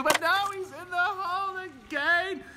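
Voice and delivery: ecstatic call. Pretend. Announcer ecstatic at another hole in one. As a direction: soar up on the call, slow.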